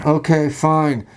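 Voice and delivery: very slow, giving up; a worn-out DM caving in; resigned DM. Retold or imitated. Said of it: "you just going".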